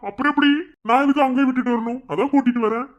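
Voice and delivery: loud, high-pitched comeback; defiant retort. Acted. Husband goading wife about bringing his girlfriend here, defiant. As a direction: argue back at the top of the range.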